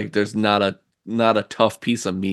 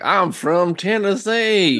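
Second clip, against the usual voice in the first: mock Southern drawl; 10 semitones higher, much slower than usual. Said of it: "You can't be like".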